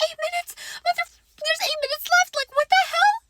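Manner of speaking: very high, squealed outrage; hammed-up high-pitched panic; inner freak-out. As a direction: squeal in disbelief, building to loud. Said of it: "but inside I was like".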